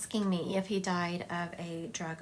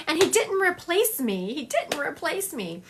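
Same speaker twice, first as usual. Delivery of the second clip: higher and louder, insisting; spirit's emphatic quote; 7 semitones higher, 4 dB louder than usual. Retold or imitated. Medium passes on spirit's protest, raised and louder. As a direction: insist it, then ease off. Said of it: "And he said".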